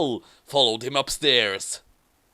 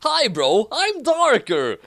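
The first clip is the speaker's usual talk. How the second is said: mock cheerful villain; 10 semitones higher than usual. Villain introduces himself.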